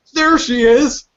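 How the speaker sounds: high, loud cry of discovery; mock alarm. Guards spotting her through thermal goggles, hammed-up alarm. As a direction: call it out high, played for laughs. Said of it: "and they're like".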